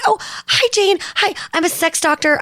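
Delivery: a light imitation of a nervous fan; shy starstruck introduction; shy Emily. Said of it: "Emily's like".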